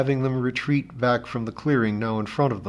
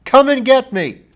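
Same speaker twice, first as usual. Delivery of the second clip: much higher and louder, head thrown back; defiant shout; 10 dB louder than usual. A narrator's reading. John Thomas defying the ship above, loud shout. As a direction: hurl the challenge up at the ship. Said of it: "and shouted".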